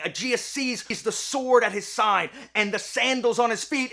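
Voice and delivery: ordinary talk, nothing marked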